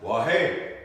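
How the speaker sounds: WAHE with the second syllable punched loud. Shown as a demonstration. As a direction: say Wahe, hitting the last syllable harder. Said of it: "say it louder in the second syllable".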